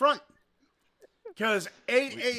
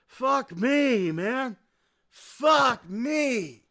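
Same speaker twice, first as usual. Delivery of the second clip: exasperated groan; 6 semitones higher, 5 dB louder, much slower than usual. Retold or imitated. Own exasperation retold.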